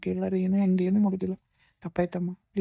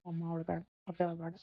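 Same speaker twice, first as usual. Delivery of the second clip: soothing hush; 8 dB quieter than usual. Acted. Son comforting crying mother.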